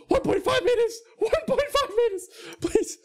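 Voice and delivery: very high, squeaky begging; mock panicked plea. Clerk begging people to keep their distance, mock panic. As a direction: beg for space in a comic high squeal.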